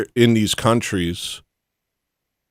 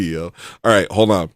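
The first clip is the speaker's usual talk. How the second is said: ordinary delivery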